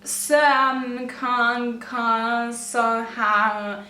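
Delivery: slow, drawn-out nonsense syllables; gibberish letters read out. Retold or imitated. Reading her garbled typed message, slow nonsense sounds. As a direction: sound out the jumbled letters very slowly. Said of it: "it was just letters".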